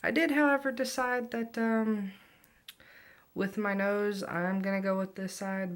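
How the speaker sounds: high, loud excited announcement. Real, not acted. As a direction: announce it eagerly.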